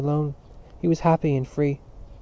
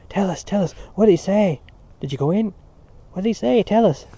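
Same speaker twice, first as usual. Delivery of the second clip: higher, eager clamour; eager schoolboys; 6 semitones higher, 5 dB louder than usual. A narrator's reading. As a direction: boys pressing for the news, high and keen. Schoolboys crowd round eagerly.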